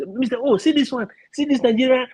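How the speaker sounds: high-pitched imitation of eager pupils; excited students. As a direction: mimic kids calling the teacher over to look. Teacher voices excited students showing videos.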